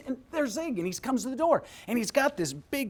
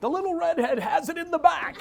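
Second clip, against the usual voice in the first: laughing quote; 9 semitones higher, 7 dB louder than usual. Retold or imitated. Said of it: "He goes".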